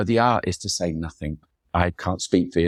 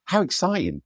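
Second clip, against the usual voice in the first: high, bright burst of eagerness; retold eager yes, pitched well up; eager enthusiasm; 10 semitones higher, slower than usual. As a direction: gush with genuine excitement. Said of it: "And I went".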